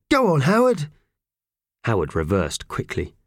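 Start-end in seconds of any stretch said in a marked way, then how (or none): 0.1-0.9 cheering on. A narrator's reading. Cheering Howard on.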